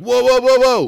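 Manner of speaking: high, loud, rapid-fire alarm; startled protest. Retold or imitated. Tourist suddenly grabbed while walking through, startled shout. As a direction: fend someone off in comic alarm. Retells being grabbed by a stranger.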